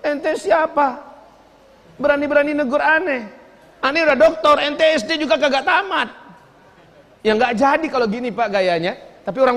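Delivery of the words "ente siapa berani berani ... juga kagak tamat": mock arrogant boss. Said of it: "dia yang mau negur".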